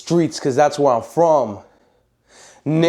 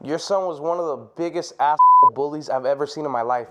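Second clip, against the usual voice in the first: much softer, hushed delivery; mock confession to the mom; 3 semitones lower, 6 dB quieter than usual. Tony imagines calling the bully's mom, hushed. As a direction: say it like a secret you shouldn't tell. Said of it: "be like".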